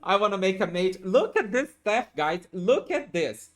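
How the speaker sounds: excited. Real, not acted. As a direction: show the chat your amazing loot. Excited about vendor staff.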